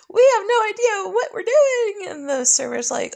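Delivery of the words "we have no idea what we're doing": high, loud wailing cook; panicked kitchen staff. Retold or imitated. Shark game cooks panicking in a high wail. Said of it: "the kitchen staff was like".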